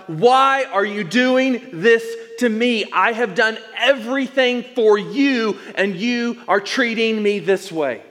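hurt, accusing shout upward, drawn out; angry cry to God. Retold or imitated. Pastor voicing his angry prayer on the porch, a loud slow protest. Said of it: "and I say to God".

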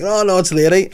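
high, flustered mock insistence; embarrassed aunt insisting at the vet, high and mock. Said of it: "she's like".

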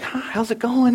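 high, sing-song friendly hello; breezy passer-by greeting; cheery greeter. Retold or imitated. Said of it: "I was kinda like".

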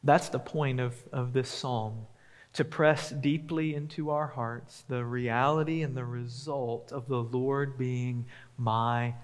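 ordinary talk

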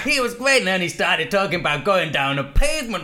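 hammed-up broad American accent. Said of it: "broad, probably offensive, uh, American accent".

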